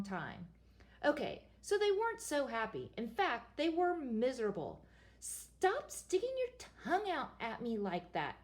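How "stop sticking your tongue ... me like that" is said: nagging. A narrator's reading. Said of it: "nagged the princess".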